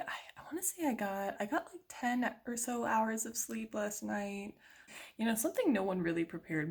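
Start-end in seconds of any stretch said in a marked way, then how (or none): 0.0-4.5 soft sleepy whisper. Real, not acted. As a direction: hush it, half awake.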